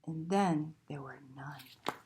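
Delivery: hushed spooky refrain; whisper. A narrator's reading. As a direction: whisper it like a secret.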